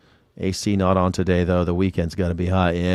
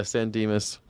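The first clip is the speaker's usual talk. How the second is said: ordinary talk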